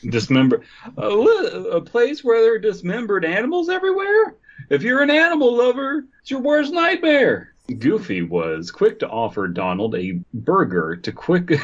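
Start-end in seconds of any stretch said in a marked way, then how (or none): 1.0-7.5 high-pitched stand-up comic delivery; Seinfeld impression. Comic riffing on slaughterhouses, high incredulous voice. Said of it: "Okay, Seinfeld".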